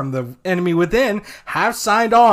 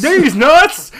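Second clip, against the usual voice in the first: laughing shout; 8 semitones higher, 6 dB louder than usual. Acted.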